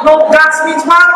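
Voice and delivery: normal talking voice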